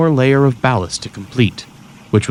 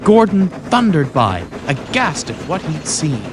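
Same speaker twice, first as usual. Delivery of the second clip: plain reading voice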